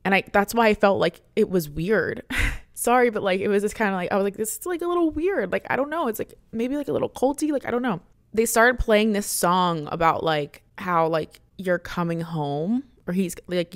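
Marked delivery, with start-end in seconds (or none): 4.4-8.0 high, softish quoted misgiving; wary doubt. Retold or imitated. Quotes own earlier doubtful reaction.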